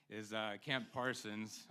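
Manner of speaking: ordinary talk, nothing marked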